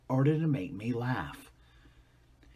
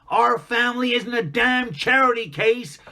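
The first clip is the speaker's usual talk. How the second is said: furious bellow, higher and louder; angry shout; 9 semitones higher, 8 dB louder than usual. Acted. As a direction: snap it out in a rage. Father's harsh words after smack.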